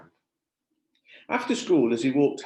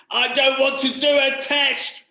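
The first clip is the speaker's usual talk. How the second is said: much higher, loud, slow yelled protest; shout; 9 dB louder, slower than usual. Acted. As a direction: shout it out in frustration. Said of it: "was what he wanted to shout".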